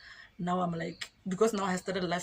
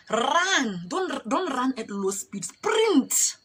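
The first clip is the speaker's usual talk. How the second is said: high, loud mock drill orders; imitated soldier yelling, slow and emphatic; shouted military command; 5 semitones higher, 4 dB louder, much slower than usual. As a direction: order a runner to go flat out.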